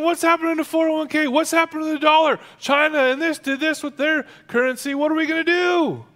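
high, loud mock panic; hammed-up freak-out. Believer panicking over dollar and currency, hammed-up freak-out. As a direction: fret frantically, far up in pitch. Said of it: "He's not freaking out".

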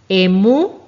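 "Emú" con la U final fuerte y alargada; acento en la U. Shown as a demonstration. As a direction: carga la última sílaba de "Emú", más fuerte. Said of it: "con acento en la U". (acento marcado en la U final de "Emú")

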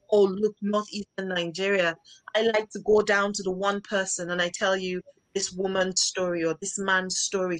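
normal talking voice

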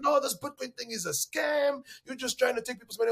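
a hammed-up skeptic calling it a scam; mock skeptical bar patron. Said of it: "They're like".

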